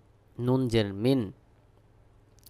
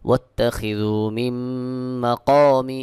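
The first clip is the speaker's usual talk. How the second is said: slow melodic tajweed chant with long held vowels; Quran recitation; 4 dB louder, much slower than usual. Shown as a demonstration. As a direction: recite slowly, drawing out each nasal and long vowel.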